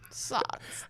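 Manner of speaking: put-on old German lady voice, whispered; German old lady accent, hushed. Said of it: "mimicking me or doing a German accent".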